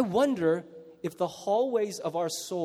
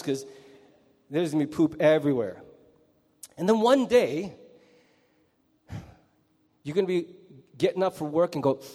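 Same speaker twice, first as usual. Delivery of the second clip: ordinary talk, nothing marked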